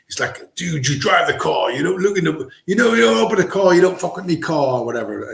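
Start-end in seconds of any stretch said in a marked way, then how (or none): 0.6-4.8 higher-pitched mock English movie accent; botched English accent. Action movie driver stating his rules, botched English accent. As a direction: do a badly faked English tough-guy accent. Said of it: "I'm doing the wrong accent".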